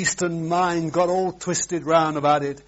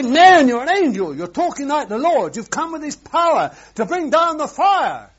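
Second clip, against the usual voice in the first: high, excited admiring listener; mock admirer; 11 semitones higher, 5 dB louder than usual. Preacher voicing an amazed listener, high and loud. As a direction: gush like an awed follower.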